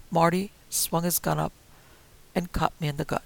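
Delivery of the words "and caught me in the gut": ordinary delivery, nothing marked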